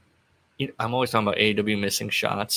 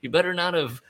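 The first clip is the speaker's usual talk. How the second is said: higher, pushy impression of a grumpy wrestler; mock threatening Dax; 7 semitones higher, faster than usual. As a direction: needle him like a sulky tough guy. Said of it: "So he's saying things like".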